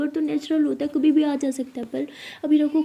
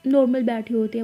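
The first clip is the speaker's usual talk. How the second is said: plain reading voice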